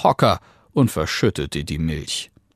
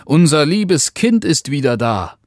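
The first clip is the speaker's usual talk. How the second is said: higher, louder happy father's cry; delighted announcement with raised voice; joyful calling; 7 semitones higher, 5 dB louder than usual. A narrator's reading. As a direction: call it out overjoyed. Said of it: "rief der Vater voller Freuden".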